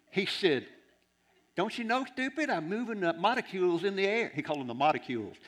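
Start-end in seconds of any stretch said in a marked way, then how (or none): 1.6-4.3 high, raised-voice explanation; exasperated retort. Retold or imitated. Bob setting the preacher straight, exasperated retort. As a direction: imitate an impatient know-it-all, pitched up.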